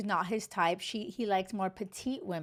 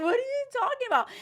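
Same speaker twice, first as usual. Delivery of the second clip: much higher, fast, outraged "what are you talking about"; incredulous disbelief; 3 dB louder, much faster than usual. Retold or imitated. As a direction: fire it back in shocked disbelief. Retells her indignant reply.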